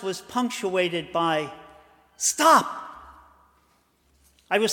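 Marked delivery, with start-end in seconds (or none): 2.2-2.8 sharp high yell; sudden shout. Acted. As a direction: cry out to stop the car. Companion suddenly cries out.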